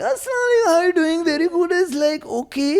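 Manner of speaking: very high, rhythmic Indian accent; sing-song Indian uncle voice. Shown as a demonstration. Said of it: "that's how I do my Indian accent now".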